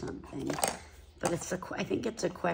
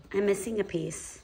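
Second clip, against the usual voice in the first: ordinary talk, nothing marked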